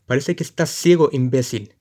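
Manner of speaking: grito rabioso y alto; escúpele el insulto gritando; insulto furioso. Acted. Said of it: "gritar furiosamente". (el cochero invisible insulta furioso)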